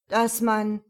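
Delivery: halting, unfinished attempt; stammering. A narrator's reading. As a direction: break off as if stuck for words. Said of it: "Emil stotterte weiter".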